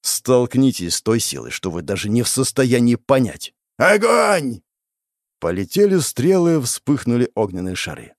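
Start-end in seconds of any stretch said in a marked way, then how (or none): 3.8-4.6 high, loud command shout; battle order shout. Acted. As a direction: bark the order to fire.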